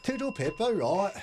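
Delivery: high, playful British toodle pip; mock British accent. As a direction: camp it up as a chirpy Brit. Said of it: "Do you do a British accent".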